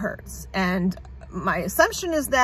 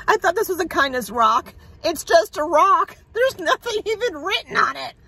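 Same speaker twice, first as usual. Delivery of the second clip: higher, laughing disbelief at the rock; disbelieving laugh; 7 semitones higher than usual. Real, not acted. Disappointed rock discovery.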